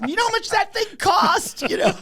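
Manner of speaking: high, strained imitation of a ripped-off friend; mock outraged yell. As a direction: yell it like you were robbed. [name] ranting about the massage.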